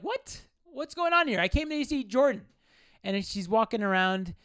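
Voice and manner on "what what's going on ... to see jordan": pitched way up in shock; what on earth is this; high, stunned what; baffled disbelief. Retold or imitated. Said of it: "And I'm like".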